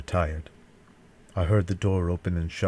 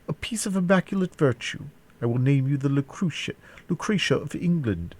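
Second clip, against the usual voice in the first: higher-pitched villain making a grand offer; wretched captor bargaining; 8 semitones higher than usual. A narrator's reading. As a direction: voice the captor, pitched up, proclaiming terms.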